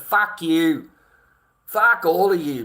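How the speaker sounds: loud, raw swearing at everyone; furious shout. Real, not acted. Angry father yelling at his viewers, furious shout. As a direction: lash out at the whole chat.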